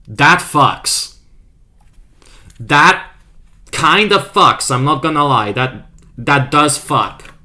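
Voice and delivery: loud, hyped approval of the art; excited praise. Real, not acted. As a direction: gush over the fan art loudly.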